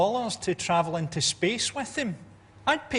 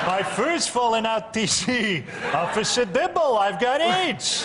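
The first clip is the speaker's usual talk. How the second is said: Top Cat voice; 2 semitones higher, 3 dB louder, slower than usual. Acted. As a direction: play a sick cartoon cat, laughing. Voicing Top Cat character.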